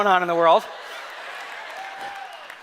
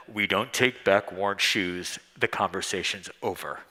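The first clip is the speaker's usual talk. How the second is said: deep clerk voice; 9 dB quieter than usual. Retold or imitated. Clerk shutting down the talk.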